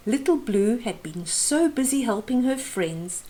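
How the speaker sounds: plain reading voice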